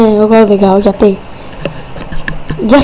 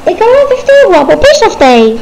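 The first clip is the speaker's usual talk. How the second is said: defensive whine; 8 semitones higher, much faster than usual. Acted. Blamed for the crash.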